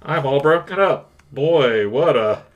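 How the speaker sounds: a hint of a nasal principal; lightly voiced smug school principal. Reads principal Rooney role.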